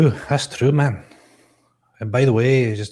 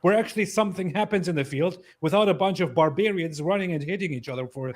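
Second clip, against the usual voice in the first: loud, high and passionate; excited; 9 semitones higher, 6 dB louder than usual. Real, not acted. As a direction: insist with full passion. Heated football defense.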